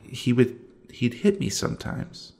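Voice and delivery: barely voiced, hushed; whispered confession. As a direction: whisper it like a secret. Confessing abuse to a friend.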